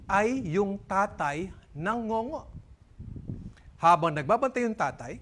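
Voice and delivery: usual speaking voice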